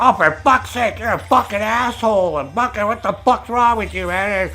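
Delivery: mock furious rant; angry outburst. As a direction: vent at the know-it-all. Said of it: "I was like".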